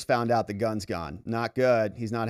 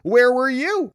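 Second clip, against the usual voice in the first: sharp high demand, loud and pitched up; demanding shout; 11 semitones higher, 8 dB louder, slower than usual. Acted. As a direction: throw the question at him hard. Voicing worried partner's texts.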